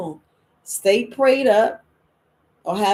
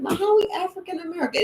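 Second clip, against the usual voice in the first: high, fast, baffled question; puzzled question to Ma; 9 semitones higher, much faster than usual. Retold or imitated. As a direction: ask it like a confused kid. Asking mom about heritage.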